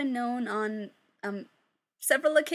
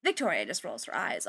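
ordinary delivery

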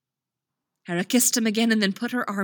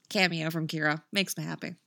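usual speaking voice

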